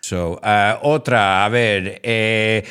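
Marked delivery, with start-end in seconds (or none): none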